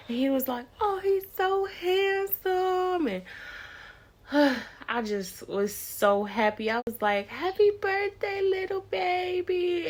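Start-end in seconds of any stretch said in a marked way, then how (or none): 0.8-3.1 doting. Retold or imitated. Dad sees grandson's picture. 7.3-9.9 put-on baby talk. Greeting newborn son.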